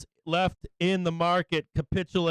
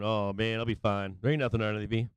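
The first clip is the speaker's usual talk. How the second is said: lower, softer mock of a carefree bear; mock complacent bear; 7 semitones lower, 5 dB quieter, much faster than usual. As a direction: play the smug bear lightly. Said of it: "you're just a bear up here going".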